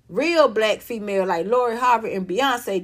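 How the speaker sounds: usual speaking voice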